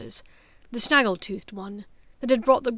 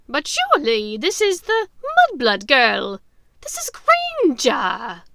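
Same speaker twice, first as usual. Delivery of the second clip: high, loud triumphant witch; gloating witch, slow on the name; Bellatrix; 4 semitones higher, 10 dB louder than usual. Acted. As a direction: crow over the captive, high and loud. Voices Bellatrix.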